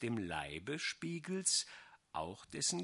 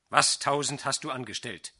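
angry sorcerer; 8 dB louder than usual. A narrator's reading. Sorcerer furious.

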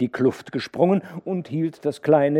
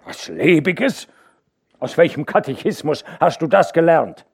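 scornful retort; 7 semitones higher, 8 dB louder than usual. A narrator's reading. Hauke scornfully questioning the worker's belief. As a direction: throw his words back at him.

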